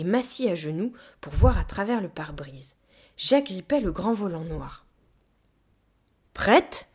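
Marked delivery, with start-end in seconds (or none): 6.3-6.9 raised, louder call. A narrator's reading. Gorilla calls to the child at the wheel, shouting. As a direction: call over to check on your partner. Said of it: "cria la gorille".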